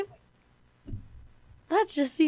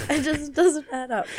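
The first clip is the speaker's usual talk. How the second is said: louder disbelief with a laugh; baffled laughing; 3 semitones higher, 10 dB louder than usual. Real, not acted. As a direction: laugh helplessly at what makes no sense.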